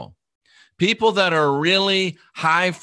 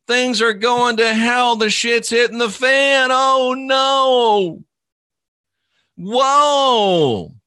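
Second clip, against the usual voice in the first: mock doom wailing; 7 semitones higher, much slower than usual. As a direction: play the gloomy doomsayers, wailing in mock despair. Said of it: "I get so excited".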